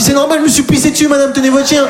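mock panicked plea. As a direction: beg her to hold the dog. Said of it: "Je dis". (supplication paniquée, très aiguë)

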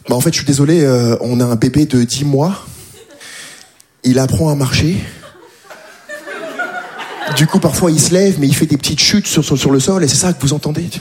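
low, calm apology; very calmly. Retold or imitated. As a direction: calmly explain about the baby. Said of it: "très calmement".

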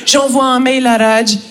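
usual speaking voice

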